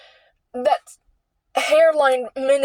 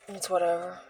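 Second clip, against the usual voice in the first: resigned; 6 semitones lower, 10 dB quieter than usual. Real, not acted. Resigned repeat, much softer.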